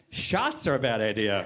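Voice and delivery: slow, low sarcastic repeat; mocking echo. Wife repeats his words back mockingly.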